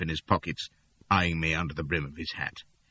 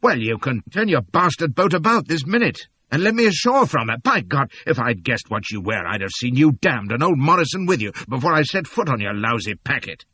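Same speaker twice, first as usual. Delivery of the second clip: loud, high furious tirade; angry outburst; 7 semitones higher, 6 dB louder than usual. Acted. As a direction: let him have it, raging. Furious at being tricked aboard.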